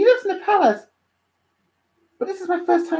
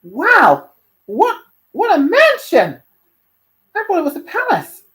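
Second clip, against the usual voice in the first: amazed; 10 dB louder than usual. Acted. Dante seeing his uncle's mansion, amazed. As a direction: exclaim in big awe, stretching the words. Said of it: "Dante looked amazed".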